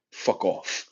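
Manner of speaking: breathy whispered brush-off; under-the-breath fuck off; whispered dismissal. Real, not acted. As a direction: whisper it like a throwaway insult. Dismissive aside after thanks.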